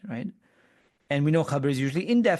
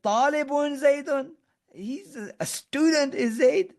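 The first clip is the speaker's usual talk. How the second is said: much higher, astonished exclamation; surprised; 11 semitones higher than usual. Pretend. Teacher voicing a surprised friend, higher and amazed. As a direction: gasp it out like you can't believe he's still a student. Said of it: "it's like surprise".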